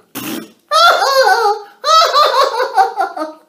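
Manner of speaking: high, loud mean laugh; gleeful cackle. Acted. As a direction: cackle at grandpa's salty tea. Alfie laughing at grandpa.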